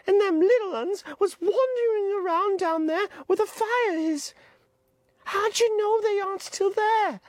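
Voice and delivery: put-on high, pleading boy voice; high-pitched anxious boy Piggy; Piggy's voice. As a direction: voice the fretful schoolboy Piggy, pitched well up. Piggy warns.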